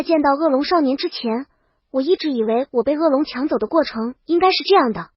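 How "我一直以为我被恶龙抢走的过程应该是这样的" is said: plain reading voice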